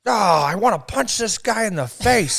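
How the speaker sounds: mock a man about to snap; hammed-up irritated viewer voice; fed-up sketch guy. Acting out sketch character.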